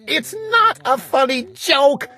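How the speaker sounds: high, pleading voice; a worried child's high plea; upset little sister. A narrator's reading. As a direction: beg him to stop, high and upset. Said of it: "my little sister screamed".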